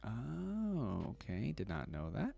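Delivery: usual speaking voice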